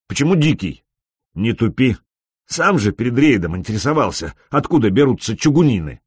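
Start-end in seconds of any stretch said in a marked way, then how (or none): 0.1-2.0 asking over a broken radio link; breaking up space radio. Acted. Radio chat question. (голос по рации с помехами)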